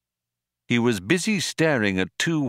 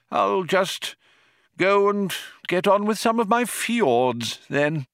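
put-on old man voice; 11 semitones higher than usual.

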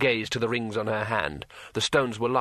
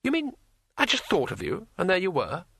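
high, excited disbelief; amazed; 7 semitones higher than usual. Acted. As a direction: squeak out the question in wonder. Two Flower amazed.

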